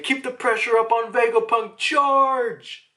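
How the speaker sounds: high, loud mock Marine charge; acted-out military yell; rallying battle cry. Voicing Marines rallying to attack.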